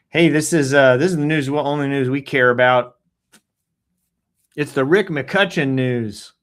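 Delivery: loud show opener announcement; announcer intro. Pretend. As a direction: boom it out like a host intro.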